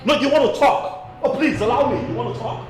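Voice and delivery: high, loud, exasperated shouting; angry outburst. Acted. Husband cuts off the interrupter in a quarrel, angry outburst. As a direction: shout over them, voice pitched high.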